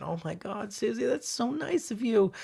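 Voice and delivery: lightly acted grateful teacher; delighted teacher. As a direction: gush sweetly, pitch climbing through the thanks. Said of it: "She said".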